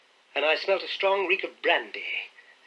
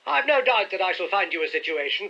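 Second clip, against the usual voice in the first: loud; 6 semitones higher, 3 dB louder than usual. A narrator's reading. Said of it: "he continued out loud".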